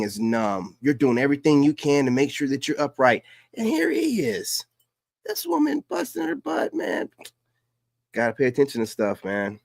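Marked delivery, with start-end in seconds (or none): 3.5-7.1 much higher, incredulous shout; high-pitched exasperated cry; exasperated outburst. Real, not acted. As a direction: squeal out the disbelief. Outraged at husband in clip.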